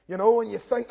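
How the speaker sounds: ordinary talk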